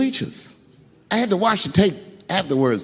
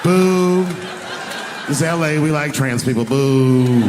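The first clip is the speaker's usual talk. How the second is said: boo him like an annoyed crowd. Retold or imitated. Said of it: "the crowd was like".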